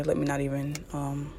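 trailing into a whisper. Real, not acted. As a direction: drop to a whisper. Trailing off to herself.

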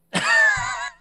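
loud, very high-pitched burst of laughter. Real, not acted. Speaker cracks up at his own retelling, high squealing laugh.

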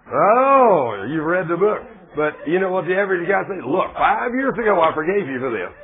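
loud, high, heated preaching; mock outburst. Preacher mimicking a grudging husband, loud and heated. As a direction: throw it out like an indignant husband. Preacher mocking the average guy.

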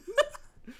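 squealing laugh; shrieking laugh; high shrieking laugh. Real, not acted.

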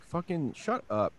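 high, slow dismissive snap; annoyed retort. Retold or imitated. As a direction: tell them to shut up, fed up. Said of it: "and I was like".

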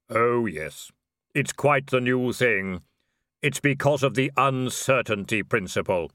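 measured, sombre otherworldly voice; Death. Acted. Robed figure explains himself to Vimes, solemn Death voice. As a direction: intone it with solemn, drawn-out weight.

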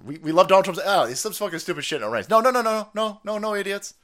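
ordinary talk

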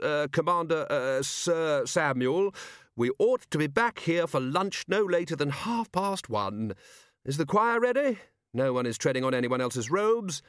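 flustered Ridcully. Acted. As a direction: hurry in all flustered and high. Ridcully leads procession.

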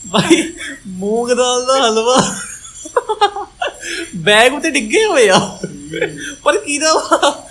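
laughing through the words; high, giggling delivery. Real, not acted. Laughing while telling funny story.

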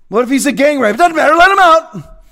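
sarcastic question, then a loud, high dismissal; scornful mock order, rising in volume; mock dismissiveness. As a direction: wave it away with mocking outrage. Mocks the release policy as absurd.

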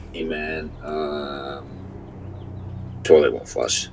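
barely audible mumbled admission; sheepish hushed confession. Retold or imitated. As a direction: admit it quietly, embarrassed. Retold confession of clogged toilet.

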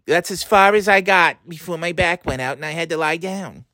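put-on high old grandma pleading for help; whiny elderly grandmother calling her grandson. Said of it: "these fucking voices".

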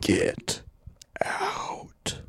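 breathy unvoiced ghost whisper, high at the end; ghostly whisper. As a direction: hiss it like an unseen spirit. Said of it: "a mysterious, chilling whisper fills the air".

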